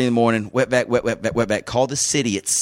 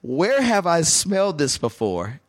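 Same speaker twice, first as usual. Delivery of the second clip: puzzled musing; 8 semitones higher, slower than usual. Retold or imitated. Retold puzzled musing at 2am.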